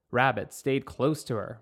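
ordinary delivery, nothing marked

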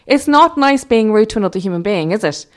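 put-on loud telling-off, as to teenagers; indignant scolding. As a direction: scold them like naughty kids. Retelling scolding men like teenagers.

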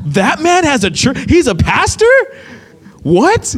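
high, incredulous string of questions; shocked disbelief. Retold or imitated. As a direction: act out a guard reeling at the news. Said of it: "He's in shock".

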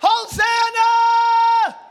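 high, loud, long-held shout; screamed praise. Real, not acted. Preacher calling the church to scream Hosanna, screamed praise. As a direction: scream it out, stretching the last vowel. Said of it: "wave something and scream".

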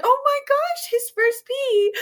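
very high, loud giddy exclamation; delighted squeal. Retold or imitated. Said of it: "and I was like".

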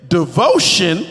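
Devotion shouted very loud; big congregational shout. Shown as a demonstration. Pastor leading the response, shouted. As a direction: belt Devotion at the other neighbor. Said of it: "and say".

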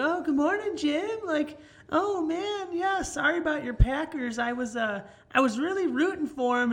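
high-pitched imitation of a chatty lady; sympathetic coworker lady. Lady consoling a Packers fan at work, high mock voice. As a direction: mimic her in a high, sympathetic voice.

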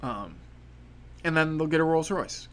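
usual speaking voice

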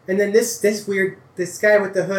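lightly voiced farm boy; young farmhand. GM voicing Tabi NPC.

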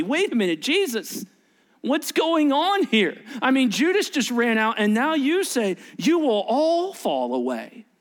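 stunned objection, voice climbing; incredulous protest. Retold or imitated. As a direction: act out a baffled disciple challenging the teacher. Disciples react in shock to prediction.

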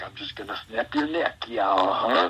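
low, menacing wrestler impression; quiet Macho Man. Wrestler scarier when quiet, low and threatening. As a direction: threaten in a low, scary hush. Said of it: "a quiet Macho Man".

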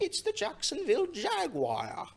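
hammed-up posh British accent; mock British accent, slow. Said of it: "the correct British".

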